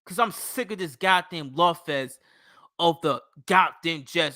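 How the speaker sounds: ordinary delivery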